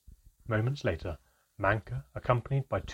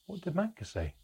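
tense, higher pitched asking; tense question; 10 semitones higher, 3 dB quieter than usual. A narrator's reading. Narrator asking about Manca, suddenly tense. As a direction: ask it eagerly but on edge. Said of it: "I asked, suddenly tense".

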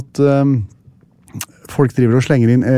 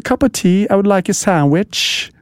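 posh British accent; 5 semitones higher than usual. Shown as a demonstration. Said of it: "Oxford English, proper, snobbete".

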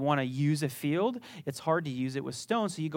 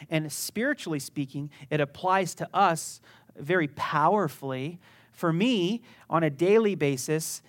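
usual speaking voice

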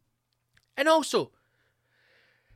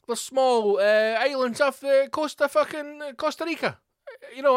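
high, exasperated appeal; hammed-up protest, pitched up; mock pleading critics; 6 semitones higher than usual. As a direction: plead indignantly as the worried world. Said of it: "everyone's like".

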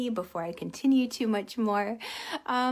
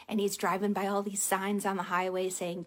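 ordinary delivery

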